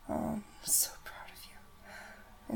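slow, lingering whispered praise; tender praise. Acted. Caretaker praising her partner, slow and tender. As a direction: draw it out, savouring each word.

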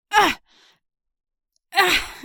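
high startled yelp; surprised yelp. Acted. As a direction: yelp as she gets stuck. Stuck in the window.

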